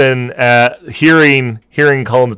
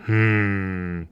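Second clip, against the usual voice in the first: ordinary talk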